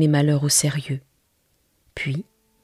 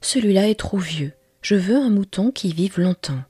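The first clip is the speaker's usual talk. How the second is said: put-on a touch higher, lightly childlike; little prince's voice; 5 semitones higher, 3 dB louder than usual. The little prince rejects the old sheep, in a lightly higher child voice. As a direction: gently turn the drawing down like a fussy boy.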